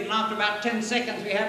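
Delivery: usual speaking voice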